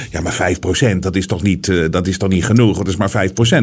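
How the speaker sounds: low, offhand doubter's voice; imitated dismissive sceptic. Host voicing people's first reaction to five percent, dismissive. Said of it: "de eerste reactie van mensen is dan altijd".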